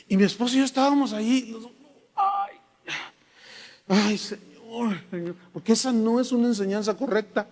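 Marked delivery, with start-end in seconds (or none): 2.1-5.4 mock moaning. Mocking pastors who pretend to feel God, with a wailing moan. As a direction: ham up a fake spiritual groan.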